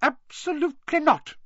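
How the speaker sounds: very high, loud, emphatic refusal; high-pitched stern nurse; strict matron. A narrator's reading. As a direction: refuse outright as a fussy matron. Strict matron refusing Harry's plea.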